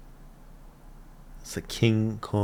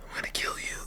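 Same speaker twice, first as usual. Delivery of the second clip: soft, unvoiced threat; whispered threat; 9 dB quieter, much faster than usual. Real, not acted. Viewer threatening Ulf on screen, whispered menace.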